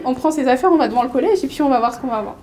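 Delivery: high-pitched playful fight challenge, amused; mock annoyance. Schoolgirl challenges her teaser to a fight, mock-angry. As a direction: act out a feisty schoolgirl's challenge. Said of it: "je me suis énervée".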